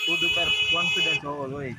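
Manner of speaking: over-the-top motivational shout; dramatic proclamation. Pretend. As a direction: belt it out like a big hype line. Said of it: "હા, લે, વગાડી લેવાનો".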